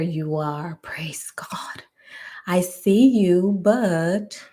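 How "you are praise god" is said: whispered thanks, much softer; relieved whisper. Real, not acted. Spotting her guest online at last, whispered relief. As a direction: breathe out in relief.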